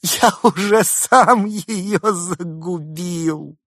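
high, loud, drawn-out hysterical cry; slow, squealed confession, laughing; shaking with laughter. A narrator's reading. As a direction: wail it out through helpless laughter. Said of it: "продолжая трястись от смеха".

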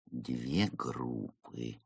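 soft, very slow note-taking murmur; muttering while writing. A narrator's reading. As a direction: mumble it as you write it down. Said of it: "вывел в своём блокноте Марио". (тихо и медленно под нос)

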